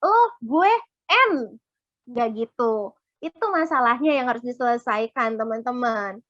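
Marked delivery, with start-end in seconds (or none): 0.0-1.6 high, loud and slow, a dramatic sigh of quitting; hammed-up exit from a conflict; mock giving up. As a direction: drag out a theatrical "I'm done". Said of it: "bukannya kabur gitu kan".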